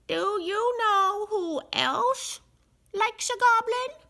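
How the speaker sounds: sly goblins teasing; goblin crowd voice. A narrator's reading.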